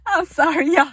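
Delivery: high loud laughter through the words; laughing. Real, not acted. To viewers, laughing at mama high and loud. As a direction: apologize while cracking up.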